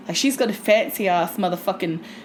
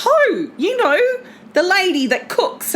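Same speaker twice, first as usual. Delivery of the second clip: higher, louder mum voice; imitating mum; 9 semitones higher, 4 dB louder than usual. Mum explaining who Ho is, higher and louder. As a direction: insist like a sure mum. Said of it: "Mum goes".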